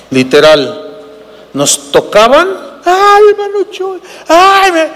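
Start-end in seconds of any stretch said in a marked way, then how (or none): 2.8-4.9 high mock fan shriek; hammed-up screaming admirer. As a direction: squeal a name like a swooning fan. Fans touching him, screaming.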